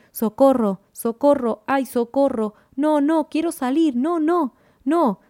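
high, loud cries building in panic; desperate screaming plea. A narrator's reading. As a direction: beg frantically to be let out, pitch climbing. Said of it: "la voz grita desde las profundas".

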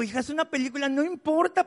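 retold parental exasperation, rising in pitch; mildly exasperated father. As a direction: act out a parent pleading for sense. Father reasons with crying daughter.

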